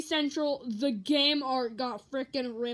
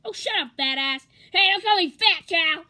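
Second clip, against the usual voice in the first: Cartman bickering; 5 semitones higher, 7 dB louder than usual. Acted. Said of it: "impression of Cartman".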